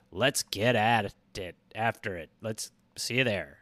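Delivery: a goofy gravel-free low voice to close the ad; gruff sign-off voice. Pretend. Host signing off to his fans, in a mock low growl.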